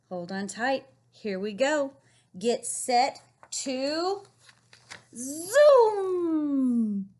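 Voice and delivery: slow, building to a loud zoom; excited read-aloud. Acted. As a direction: launch the final word like a ride. Rereading the climax.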